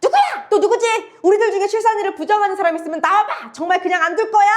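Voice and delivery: furious outburst. Acted. Eldest daughter slaps the third son, shouting in fury. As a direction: lash out while striking the brother. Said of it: "갑자기 장녀가 화가 나서".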